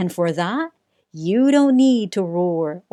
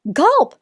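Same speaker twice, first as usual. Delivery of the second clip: much higher mock gulp; nervous gulp; 11 semitones higher, 5 dB louder than usual. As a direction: swallow hard, scared. Mouse gulps in fear.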